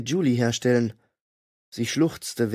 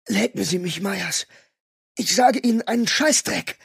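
forced out; 7 semitones higher than usual. A narrator's reading. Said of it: "stieß ich mühsam hervor".

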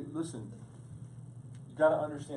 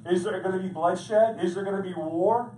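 higher, louder revival preaching climb; preacher rising to a fervent pitch; full force preaching; 7 semitones higher, 8 dB louder than usual. Acted. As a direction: drive the question home to the congregation.

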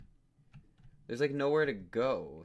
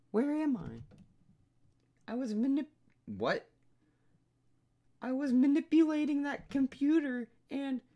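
put-on dazed high girl explaining; 14 semitones higher, 5 dB louder than usual. Gamer voicing Hinata confused after being knocked down, high. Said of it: "I gave her the same voice as Rika".

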